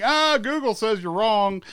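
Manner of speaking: imitating someone catching a lie; mock know-it-all. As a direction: gloat like you just caught a liar. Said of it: "they're like".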